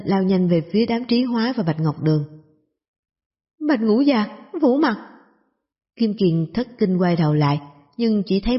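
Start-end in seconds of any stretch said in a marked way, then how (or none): 3.7-5.0 urgent shout. Acted. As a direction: yell out the warning in alarm. Warning cry as arrows fly.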